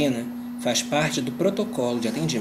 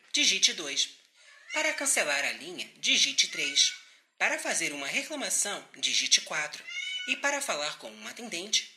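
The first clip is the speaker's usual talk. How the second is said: phone menu voice; 3 semitones higher, 5 dB quieter than usual. Acted. Automated call center phone menu.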